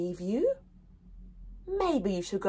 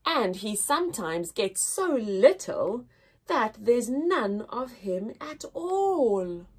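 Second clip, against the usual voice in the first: put-on poem reading to children. Teacher reading the poem aloud to her class. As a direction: read it gently with long pauses.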